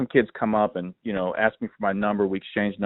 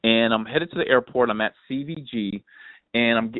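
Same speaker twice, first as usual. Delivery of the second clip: normal talking voice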